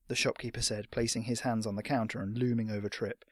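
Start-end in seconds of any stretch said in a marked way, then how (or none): none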